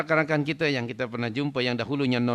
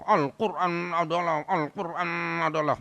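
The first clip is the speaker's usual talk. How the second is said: mock eloquent orator; 5 semitones higher than usual. Preacher mimicking how eloquently we talk about the Quran, mock oratory. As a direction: parody a fluent speaker, stretching the words. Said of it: "kalau cakap kita petah".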